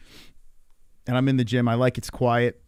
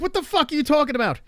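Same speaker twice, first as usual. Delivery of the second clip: normal talking voice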